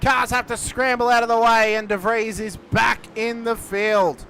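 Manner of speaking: ordinary talk, nothing marked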